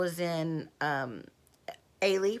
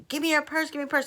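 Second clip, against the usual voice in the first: higher, louder insistent demand; demanding mugger; 8 semitones higher, 3 dB louder, much faster than usual. Retold or imitated. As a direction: bark it like a mugger grabbing the purse. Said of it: "he was like".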